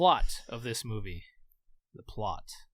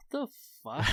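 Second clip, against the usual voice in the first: baffled; 14 semitones higher than usual. Retold or imitated. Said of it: "it wasn't like Rubber where I'm like".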